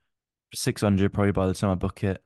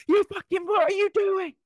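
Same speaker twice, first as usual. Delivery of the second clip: shrill, much higher and louder mock insult; hammed-up furious shouting, very high; mock furious yell; 3 dB louder than usual. Friend acting out the angry insults in the car, mock furious yell.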